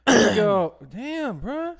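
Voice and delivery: cracking up into a squeak. Real, not acted. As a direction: voice breaking high. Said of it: "Why are you cracking your voice for?".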